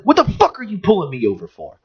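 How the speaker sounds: high-pitched indignant demand; retold angry outburst. Driver retelling how he confronted the cop, indignant. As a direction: re-enact being fed up, pitch up without extra volume. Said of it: "when I started fucking screaming at".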